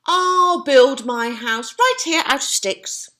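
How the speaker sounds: high, loud excited piglet; excited squeal. Acted. Boris picking sticks, high excited squeal. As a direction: squeal it out with glee. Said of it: "Boris squealed excitedly".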